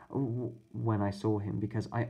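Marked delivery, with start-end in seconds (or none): none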